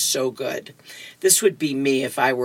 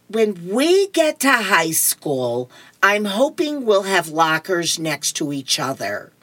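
put-on comic-strip girl; 2 semitones higher than usual. Voices Lucy dreaming at piano.

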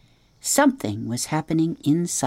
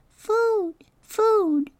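very high tiny peep; peeping gosling. Acted. Newly hatched gosling peeping at the robot. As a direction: newborn chick calling for mama.

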